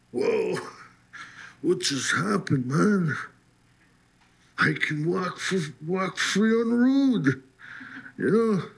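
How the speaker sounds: high, stunned, can't believe it; freed man's giddy astonishment outside the station; amazed disbelief. Real, not acted. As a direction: marvel at walking free, voice lifted high.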